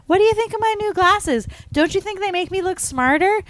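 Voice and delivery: very high, loud movie-character impression; high-pitched mock girlish voice; Marty. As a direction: squeal it like a girl showing off. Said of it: "Marty gets new glasses and she's like".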